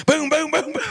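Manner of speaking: very high, loud imitated shots; empty the lever action in a rush; gunshot booms. Son emptying his dad's rifle at the doe, high booms.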